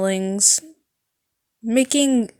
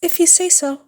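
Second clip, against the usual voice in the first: whisper; 8 semitones higher than usual. Acted. Nurse relaxed, shy reply.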